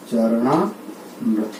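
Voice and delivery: teacher slowly sounding out the word; slow demo. Shown as a demonstration. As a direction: draw out चरणामृत to show the joined आ. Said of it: "ये मिलके क्या बन गया आपका".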